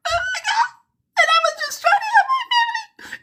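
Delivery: very high hammed-up sobbing wail; mock crying. Newcomer at the cell door, hammed-up high sobbing. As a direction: wail it like a blubbering mock cry. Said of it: "And you're there crying".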